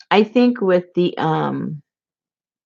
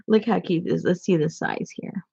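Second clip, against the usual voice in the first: normal talking voice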